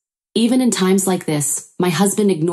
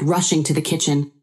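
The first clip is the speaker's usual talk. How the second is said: ordinary delivery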